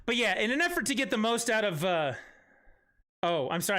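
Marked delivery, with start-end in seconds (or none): none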